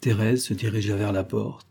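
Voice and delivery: ordinary delivery, nothing marked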